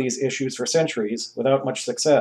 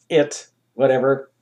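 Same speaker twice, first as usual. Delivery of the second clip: plain reading voice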